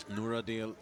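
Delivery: usual speaking voice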